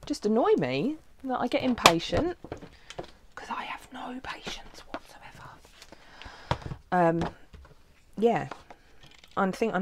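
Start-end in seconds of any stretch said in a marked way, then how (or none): 3.3-5.5 breathy whisper confession; soft whispered self-mockery; whispered aside. Real, not acted. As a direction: whisper it like a guilty secret.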